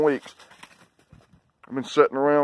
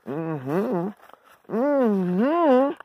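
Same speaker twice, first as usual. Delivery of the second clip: cooing; 9 semitones higher, much slower than usual. Real, not acted. Cooing to dog.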